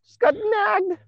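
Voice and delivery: high, fast mock panic; panicked caller. Mocking a frantic 911 caller, high fast panicked voice. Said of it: "Where you get a phone call and like".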